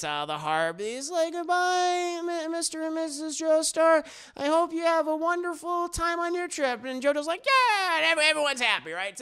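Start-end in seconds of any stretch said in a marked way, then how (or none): 1.3-6.8 high, sing-out farewell voice; Poco calling goodbye. Acted. Boy Poco calls after departing boat. 7.5-8.0 high, loud happy cheer; hammed-up joyful call back; JoJo cheering back. JoJo answers the farewell happily.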